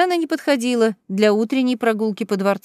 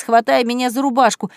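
ordinary delivery, nothing marked

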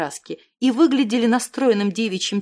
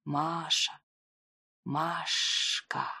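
tender hush; 6 semitones lower, 10 dB quieter, much slower than usual. A narrator's reading. Leo savours her name.

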